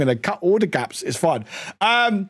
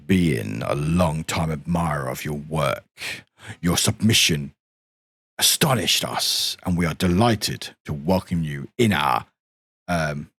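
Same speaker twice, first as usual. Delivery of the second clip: lower, solemn voice reading the welcome letter; deep voice; 9 semitones lower than usual. Acted. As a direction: read the letter like a solemn old caretaker. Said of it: "I like doing my deep voice".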